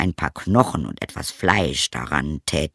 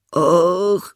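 put-on high, loud wail of an old hound; pitiful howling dog; 10 semitones higher, 5 dB louder than usual. A narrator's reading. Old hound answers the donkey, pitiful howling dog voice. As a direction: sigh it out high and woeful. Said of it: "der jämmerlich heulte".

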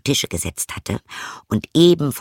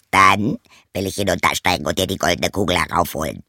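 lower, croaky frog soothing her; a low frog comforting the girl; 3 semitones lower than usual. Acted. Frog tells crying princess to hush, low croaky voice.

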